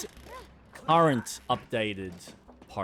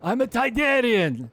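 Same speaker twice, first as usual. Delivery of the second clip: higher, louder Watto impression; 5 semitones higher, 4 dB louder than usual. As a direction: play the greedy junk dealer. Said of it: "supposed to be doing that in, um, Watto's".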